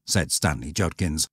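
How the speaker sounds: plain reading voice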